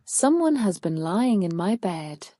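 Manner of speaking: ordinary delivery, nothing marked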